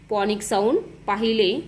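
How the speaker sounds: ordinary talk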